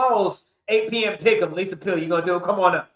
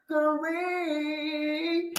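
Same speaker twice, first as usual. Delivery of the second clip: drawn-out hype; 8 semitones higher, 7 dB quieter, much slower than usual. Acted.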